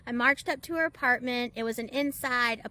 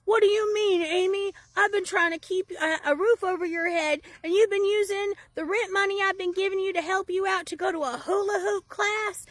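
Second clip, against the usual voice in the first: higher, louder incredulous man's voice; outraged neighbour; 7 semitones higher, 4 dB louder than usual. Retold or imitated. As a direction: sound betrayed and disbelieving. Neighbour outraged at rent misuse.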